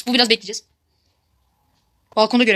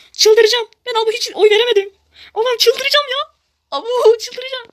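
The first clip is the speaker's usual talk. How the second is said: frustrated shouting; 11 semitones higher, 5 dB louder, slower than usual. Real, not acted. Can't vote, game frustration.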